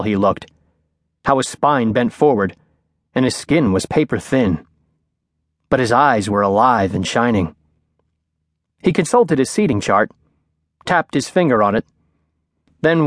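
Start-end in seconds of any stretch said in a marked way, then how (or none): none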